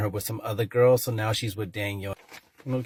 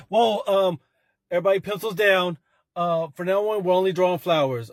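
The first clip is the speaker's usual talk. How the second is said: high, loud, hurried alarm; much higher and louder flustered command to the class; alarmed teacher; 8 semitones higher, 6 dB louder than usual. Acted. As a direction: shut the class activity down fast.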